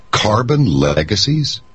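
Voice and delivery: disbelieving high echo of the phrase; incredulous. Real, not acted.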